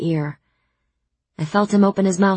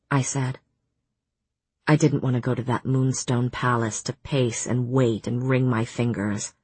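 plain reading voice

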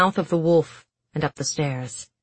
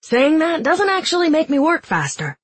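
much higher, whiny complaint; complaining; 11 semitones higher, 4 dB louder than usual. Acted. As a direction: grumble while concentrating. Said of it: "Jesper complained".

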